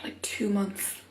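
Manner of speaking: breathy whisper, as if ashamed; whispered confession. Retold or imitated. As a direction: confide it in a hush. Quoting blogger's hair confession.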